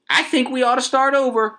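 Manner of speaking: usual speaking voice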